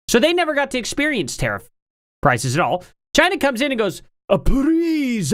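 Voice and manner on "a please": put-on a brief, half-hearted Chinese accent, higher; attempted Chinese accent. China pleading with America, in a half-hearted mock Chinese accent. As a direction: start a mock Chinese accent, then drop it. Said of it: "I'm not gonna do an accent".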